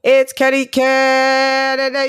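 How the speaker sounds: slow, loud ring announcer call; announcer intro. Acted. Host introducing the show like a ring announcer, loud and slow.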